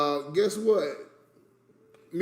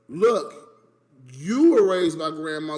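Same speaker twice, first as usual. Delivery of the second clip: loud, pointed rebuke; heated argument; 3 semitones lower, 6 dB louder, slower than usual. Real, not acted. Nephew loudly reminding his uncle how he was raised. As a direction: jab it at him louder.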